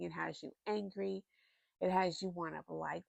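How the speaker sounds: ordinary talk, nothing marked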